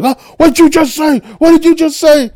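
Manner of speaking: very high, loud mock shout; hammed-up shrieking challenge; outraged hothead. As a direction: imitate someone hopping mad and spoiling for a fight. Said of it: "was jumping up and down like".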